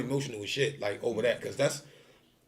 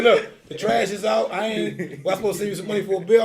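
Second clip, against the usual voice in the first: higher and louder pleading; flustered; 7 semitones higher, 7 dB louder than usual. Retold or imitated. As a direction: list your good deeds in a panic. Lists chores in mock defence.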